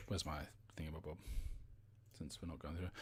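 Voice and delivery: very soft, talking to oneself; muttered aside. Real, not acted. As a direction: drop right down while hunting for something. Muttering while hunting for a misplaced item.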